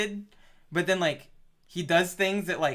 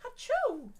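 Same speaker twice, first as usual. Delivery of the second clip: high squeaky fake sneeze; mock sneeze; 4 dB quieter than usual. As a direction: echo the sneeze high and silly. Mocking the sneeze just heard.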